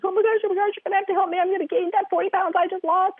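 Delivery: high-pitched panicked rush, imitating a client; panicking client. Client rushing in to her therapist, mock panic. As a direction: mimic someone rushing in panicking. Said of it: "she was panicking".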